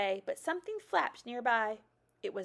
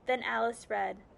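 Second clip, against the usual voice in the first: usual speaking voice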